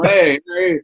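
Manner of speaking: high, loud, showy New Yorker patter; New York accent. Acted. Asking strangers if that's enough, hammed-up New York accent. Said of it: "say something in New Yorker".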